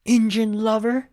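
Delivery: high, loud cartoon cowboy holler; mock cowboy yell. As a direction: hurl it as a hammed-up insult. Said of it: "Boone yells at him".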